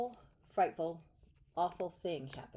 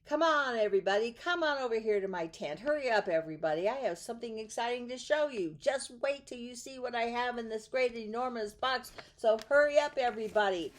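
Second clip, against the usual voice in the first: loud, high, fast showman calling out; barker's shout; 5 semitones higher, 6 dB louder, faster than usual. Acted. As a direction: call the crowd in like a circus barker. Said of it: "the circus man would shout".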